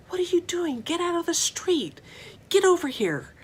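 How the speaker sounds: put-on high, loud alarmed yell at a child; yelling mom. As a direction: shout it like a panicked mother calling her kid off the street. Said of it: "yells at the child and says".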